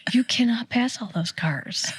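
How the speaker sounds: hushed whisper; whispered aside. Retold or imitated. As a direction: whisper it like a secret. Whispered retort.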